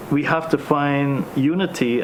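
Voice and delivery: usual speaking voice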